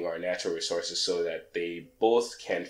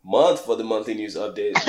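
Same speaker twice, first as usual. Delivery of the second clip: usual speaking voice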